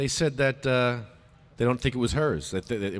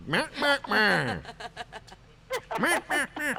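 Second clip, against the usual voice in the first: high laughing bleats of meh; mocking meh; 12 semitones higher, 4 dB quieter, slower than usual. Teasing with meh sound.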